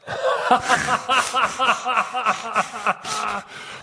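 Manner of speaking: long high laugh; real burst of laughter at his own story; hearty laugh. Real, not acted. Laughing hard at the skunk punchline, high and long.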